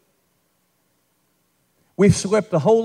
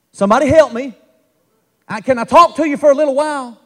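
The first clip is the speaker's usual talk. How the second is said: full-force preaching; 2 semitones higher than usual. Acted. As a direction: preach it out to stir the room. Pastor preaching to revival crowd.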